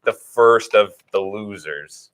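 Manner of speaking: first stretched slow and loud; drawn-out emphasis on first. Acted. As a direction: lean hard on first, teasingly. Teasing that the opponent finished first.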